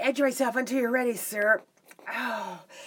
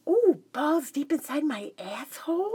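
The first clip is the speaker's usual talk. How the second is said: ordinary talk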